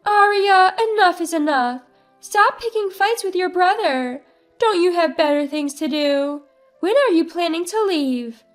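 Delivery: scolding mother. Acted. Mother scolding her daughter in the kitchen, high and loud. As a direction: tell her off sharply from the doorway. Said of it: "my mom says".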